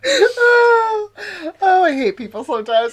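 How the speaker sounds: high laughing cry; laughing groan. Real, not acted. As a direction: laugh it out, exasperated. Exasperated laugh after joke.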